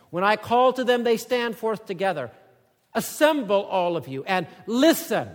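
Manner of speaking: loud, high preaching voice; forceful scripture reading. Acted. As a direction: read God's words like a summons. Reads God's words from verse thirteen.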